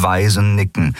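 plain reading voice

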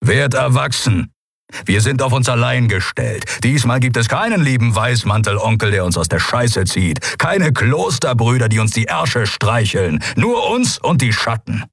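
hard, scornful lecture to the crew; stern commander, contemptuous and resolute; gruff stern crewman. Acted. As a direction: lay down the grim truth forcefully.

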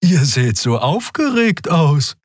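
high, slow character voice; Xea's voice. A narrator's reading. Character greeting two excited crewmates, slow and high. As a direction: remark on it in drawn-out, high tones. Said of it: "grüßte Xea".

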